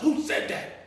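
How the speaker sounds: a scared, voiceless whisper; whispered question. Retold or imitated. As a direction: whisper it as if something might hear. Scared kid whispering to the room.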